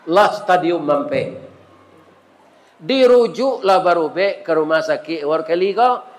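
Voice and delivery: normal talking voice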